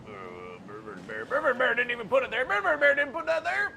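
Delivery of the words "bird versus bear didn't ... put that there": high, loud, fast protest; indignant villain. Acted. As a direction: deny it with mock outrage. Villain denying, rising.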